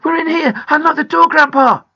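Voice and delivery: high, loud, urgent yell; crying out. A narrator's reading. Boy begging Grandpa to unlock the door, crying out. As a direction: call through a locked door. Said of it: "cried Peter".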